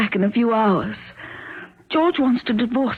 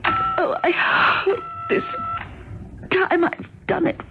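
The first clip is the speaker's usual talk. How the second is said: strained sobbing; 8 semitones higher, slower than usual. Acted. Struggling alone, tied up.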